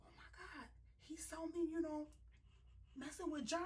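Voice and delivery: high, soft mock inner voice, muttering; talking to herself. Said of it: "she's saying to herself".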